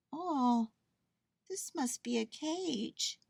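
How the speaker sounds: high, slow little bear voice; high-pitched bear, wondering; Corduroy. A narrator's reading. Bear peeks through the basket bars, wondering.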